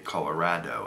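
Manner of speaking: "Colorado" with "rad" as in "mad"; Indiana pronunciation. Shown as a demonstration. Vlogger showing how Indiana people say it, rad-o ending. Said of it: "'cause people from Indiana".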